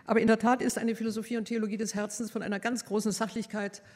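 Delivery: ordinary delivery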